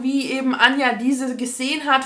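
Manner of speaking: ordinary talk, nothing marked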